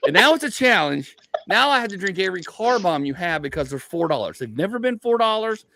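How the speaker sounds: worked-up retelling. Retold or imitated. Comic telling friends about cheap car bombs, worked up. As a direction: make the deal a grand challenge. Retells rising to drink-deal challenge.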